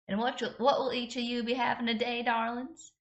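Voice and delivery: high, sugary American southern waitress; a diner waitress in a drawling American southern accent. Acted. Waitress taking the table's order, in an American southern accent. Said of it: "I'm giving her an American southern accent".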